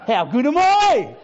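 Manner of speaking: slow, high, loud gloating; mock boast. Pastor jokingly boasting after the reconciliation, hammed-up gloat. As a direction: ham up the self-praise.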